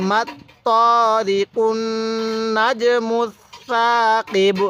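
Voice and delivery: slow chant, long ত in মাত ত and hummed কুন; syllable-by-syllable recitation, কুন held nasally; model recitation. Shown as a demonstration. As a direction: stretch the long vowels of সাকিব. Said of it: "এরকমভাবে পড়ব".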